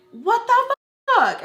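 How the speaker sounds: high, loud, drawn-out outrage; outraged yell. Retold or imitated. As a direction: yell it, stretching the words. Retold yelling quarrel over charger.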